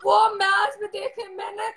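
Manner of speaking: real crying, subdued beside an outburst; tearful. Real, not acted. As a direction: confide a painful memory through tears. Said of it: "रो मत आप।". (रुंधे गले से, रोते हुए)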